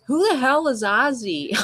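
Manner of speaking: a mother who has no clue what you mean; baffled mom. Retold or imitated. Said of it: "And my mom's like".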